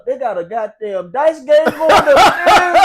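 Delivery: high, loud, slowed hype voice; imitating Amp. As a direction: sell the dorm perks like the older roommate. Amp describing dorm perks.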